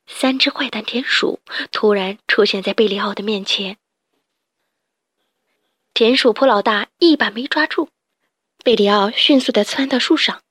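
ordinary delivery

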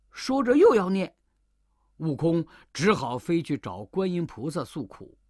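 ordinary delivery, nothing marked

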